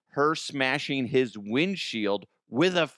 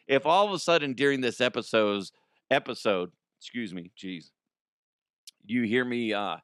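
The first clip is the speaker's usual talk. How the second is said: ordinary delivery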